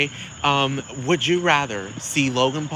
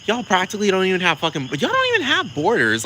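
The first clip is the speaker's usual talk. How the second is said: higher, fast, sassy dismissal; imitating Ashley; 6 semitones higher than usual. Ashley brushing off Serge, higher and fast. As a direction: rattle it off high and quick, mocking Ashley. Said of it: "And Ashley's like".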